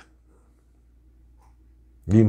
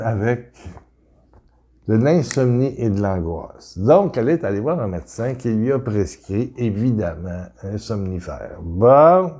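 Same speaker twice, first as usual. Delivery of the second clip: ordinary talk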